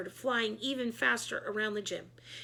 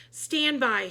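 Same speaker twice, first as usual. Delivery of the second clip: louder mock shout; 4 semitones higher, 6 dB louder than usual. A narrator's reading. Principal shouting to everyone in the gym. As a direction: call out a command. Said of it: "shouted Mister Klutz".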